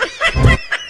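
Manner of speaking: loud high burst of laughter. Real, not acted. Host laughs at joke.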